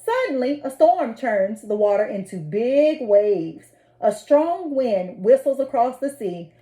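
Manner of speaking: plain reading voice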